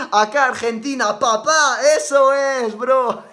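loud, high, hammed-up Argentine shout; mock Argentine accent. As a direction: play the over-the-top Argentine fan. Mimicking Argentines after praising them. (acento argentino de broma, muy alto y agudo)

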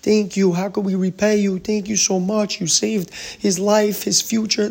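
hammed-up gratitude to the doctor; grateful tearful parents. Parents thanking the doctor after the surgery, high pleading gratitude. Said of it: "They're crying, they're celebrating".

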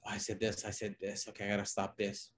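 muttering self-correction under the breath; quiet, inward self-critique while replaying your own recording. Presenter reviewing her own recording, muttering quietly to herself. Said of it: "recording yourself, listening over".

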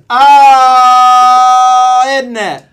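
huge high British "Oh", then a punchy tag; loud mock-British comeback; British hooligan retort. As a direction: bellow back like a rival British fan. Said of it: "Let's put a British interpretation on this".

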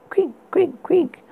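high breathy creak whispered. A narrator's reading. Creaking sound effect voiced.

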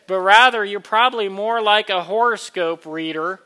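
loud, earnest preaching voice; kindly vicar sermon. Acted. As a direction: preach it warmly to the room. Communion invitation, preached loudly.

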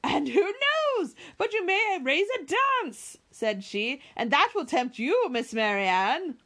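put-on high, slow, coaxing old lady; teasing matron. A narrator's reading. Mrs Jennings teasing Marianne about a dance, high and coaxing. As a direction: tease her with a sing-song lilt.

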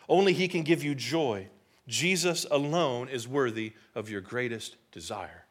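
ordinary talk, nothing marked

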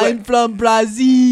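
loud, drawn-out fake Brazilian accent; botched Brazilian accent. Player proving he can do this, loud slow botched Brazilian accent. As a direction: proclaim it in a terrible Brazilian accent, stretched out. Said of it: "I can't do a Brazilian accent".

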